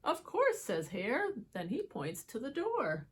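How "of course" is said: light high rabbit voice; chirpy high character voice. Acted. Hare speaks.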